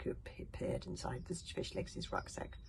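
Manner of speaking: ordinary delivery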